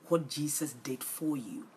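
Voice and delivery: ordinary delivery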